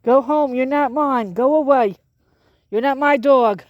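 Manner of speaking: loud high yell at a stray dog; neighbor yelling. Real, not acted. Neighbor yelling at a dog that isn't his, loud. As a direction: shout it at the dog to go home. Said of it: "The neighbor yelling at him".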